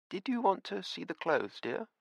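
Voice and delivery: a hint of a sweet old hostess; the widow offering Nevada the clothes, lightly voiced; kindly old landlady. A narrator's reading. Missus Beatty asks Nevada.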